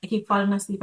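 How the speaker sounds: ordinary talk, nothing marked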